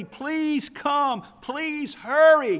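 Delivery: high, loud, frantic begging; urgent pleading. Retold or imitated. Father begging Jesus to hurry to his dying daughter, frantic plea. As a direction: cry out like a father racing time. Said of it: "Don't you hear the hurry in his voice?".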